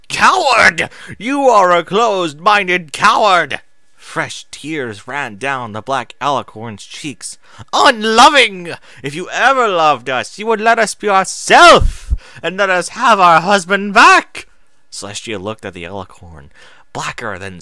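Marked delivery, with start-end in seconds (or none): 0.1-3.6 high, loud, contemptuous cry; furious scream. Acted. Moon Shadow screams at Celestia. 7.7-14.5 high, loud, rising to a shriek; tearful furious yell. Acted. Tearful Moon Shadow yells at Celestia.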